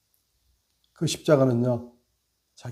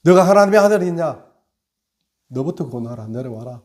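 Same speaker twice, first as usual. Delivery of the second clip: mocking jeer; 2 semitones higher, 7 dB louder than usual. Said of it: "조롱하잖아요".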